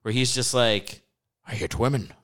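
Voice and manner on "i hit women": put-on lightly done Scottish accent, flat and blunt; a hint of a Scottish brogue, stated plainly; mild Scottish accent as Sean Connery. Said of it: "appropriating the Scottish accent".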